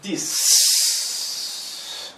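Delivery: final count with a loud, long exhale; blowing out hard. Shown as a demonstration. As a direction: blast all the breath out after the number. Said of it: "je souffle bien".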